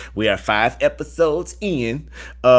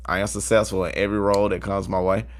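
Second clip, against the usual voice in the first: softer affirmation; 4 semitones lower than usual. Shown as a demonstration. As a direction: repeat the affirmation softer and lower. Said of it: "affirmation repeated softer".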